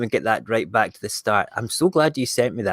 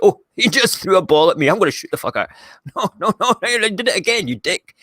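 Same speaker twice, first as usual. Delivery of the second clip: higher, panicky cartoon robot voice; robot voiceover; 6 semitones higher than usual. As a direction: voice the robot dodging balls, pitched up. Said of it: "the voiceover by me".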